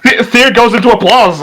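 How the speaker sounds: normal talking voice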